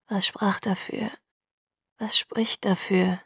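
slow, half-whispered murmur; lost in thought. A narrator's reading. Edward musing to himself about the evidence, murmured slowly. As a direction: mutter it to yourself, drifting off. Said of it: "murmelte er gedankenverloren".

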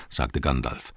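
plain reading voice

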